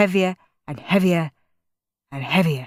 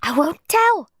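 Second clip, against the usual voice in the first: high squeak, a lot higher; squeaky little brother; 10 semitones higher, 3 dB louder than usual. A narrator's reading. Little brother squeaking a frightened promise. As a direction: squeak it like a scared little boy. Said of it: "squeaked Peter".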